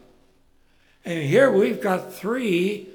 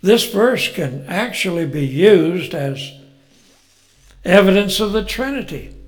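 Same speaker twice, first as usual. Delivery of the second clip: usual speaking voice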